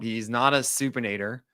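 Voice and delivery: usual speaking voice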